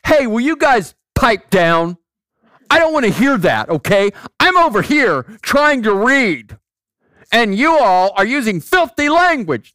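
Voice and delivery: loud, high, indignant mock shout; acted angry telling-off. Scolding guys at the bar while trying to read, acted angry shout. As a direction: yell a hypothetical complaint across the room, stretching the key words.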